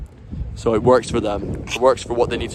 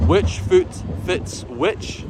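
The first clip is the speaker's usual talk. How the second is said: spaced out word by word, slowly; deliberate, stretched-out phrasing; slow, careful question; 7 semitones higher, much slower than usual. Shown as a demonstration. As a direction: ask it slowly, drawing out each word. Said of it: "somebody asks you".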